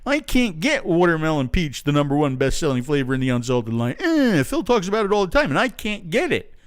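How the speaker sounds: exaggerated mock moaning; mock complaining customer. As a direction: whine it like a put-out customer. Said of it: "for those of you who are in the United States going".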